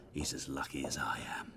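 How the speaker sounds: fast conspiratorial whisper. Acted. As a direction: lean in and whisper it quickly. Sly merchant leans in confidentially.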